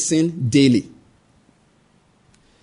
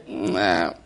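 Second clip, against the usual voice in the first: put-on baby whimper; 10 semitones lower, 8 dB quieter than usual. Preacher imitates a hungry baby.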